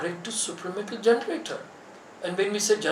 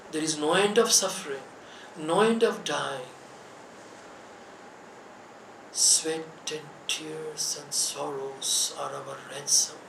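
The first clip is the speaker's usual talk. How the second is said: prayerful lament; 6 dB quieter than usual. Acted. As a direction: intone it like an ancient cry to the Lord. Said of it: "This is the cry of Savitri".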